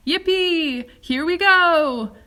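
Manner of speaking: loud, high whoop, slow at the end; whooping with glee; joyful shout. A narrator's reading. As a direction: cheer as the ride takes off. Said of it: "shouted Grandpa Joe again".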